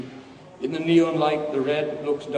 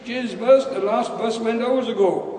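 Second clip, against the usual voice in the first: high, raised reply; calling back; 7 semitones higher, 4 dB louder than usual. Acted. Dave answering old man at the bus stop, calling back. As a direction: call the bad news back to him.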